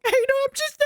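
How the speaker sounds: very high-pitched defensive whine; mock flustered. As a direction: squeak back at a stern official. Acted apology to airport official.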